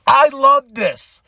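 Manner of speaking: loud, high, slow and emphatic; emphatic fan joy; delighted. Real, not acted. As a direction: draw it out high with real delight.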